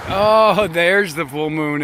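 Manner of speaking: high, loud, then slow wonder; delighted surprise. Real, not acted. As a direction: gasp as the moon comes out. Moon reappears from clouds.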